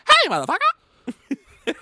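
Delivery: very high, loud, slow cartoon yell; hammed-up high cartoon shout; South Park characters. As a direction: stretch it out in a squawky cartoon voice. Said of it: "talk like those South Park characters".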